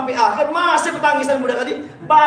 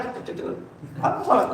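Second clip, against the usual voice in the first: whispered; 9 dB quieter, much slower than usual. Shown as a demonstration. Whispered aside while praying.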